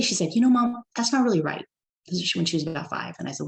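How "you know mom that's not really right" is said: put-on child correcting mom, higher and louder; a hint of a small child objecting; lightly voiced little girl. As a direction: pipe up like a kid setting mom straight.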